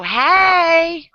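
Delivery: loud, high, drawn-out Southern hey; Southern accent. Shown as a demonstration. Showing how Southerners greet, loud Southern accent. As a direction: holler a big open Southern greeting. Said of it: "'Cause we're Southern".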